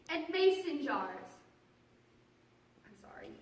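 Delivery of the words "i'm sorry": very soft, low, near-whispered aside; hushed apology. Real, not acted. Performer apologising after losing their place in the monologue, hushed. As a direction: drop out of the performance to apologise quietly.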